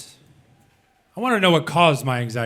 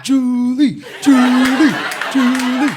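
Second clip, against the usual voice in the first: high, much louder, building adoration; awed praise; 8 semitones higher, 7 dB louder, much slower than usual. Retold or imitated. Roommates hailing Julie for her brilliant idea, loud and high. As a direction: cry her name like she saved you. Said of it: "we were like".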